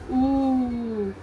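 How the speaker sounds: "oo" held out long; slow, drawn-out "oo". Shown as a demonstration. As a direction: stretch "oo" into a sustained vowel. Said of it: "you stress on the sound of oo".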